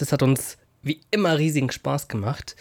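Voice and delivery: ordinary talk, nothing marked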